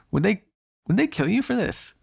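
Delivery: falter, then ask with dawning concern; worried. Acted. Worried hunter could be killed.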